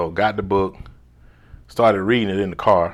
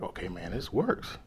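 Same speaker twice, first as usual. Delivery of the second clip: hushed realization; 12 dB quieter than usual. Retold or imitated. Quiet amazed realization.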